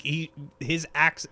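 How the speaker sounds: ordinary talk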